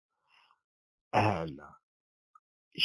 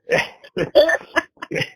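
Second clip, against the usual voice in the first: a burst of airy high laughter; high breathy laugh; 10 dB louder than usual. Real, not acted. Laughs at own joke.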